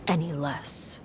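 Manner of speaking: a cold, hushed warning; menacing whisper. Acted. Father quietly warning his challenger, whispering.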